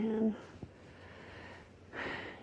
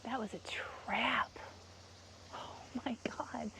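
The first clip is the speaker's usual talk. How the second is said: whisper; 7 dB quieter than usual. Real, not acted. Realising it was a trap, she whispers to herself. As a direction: whisper it as it dawns on you.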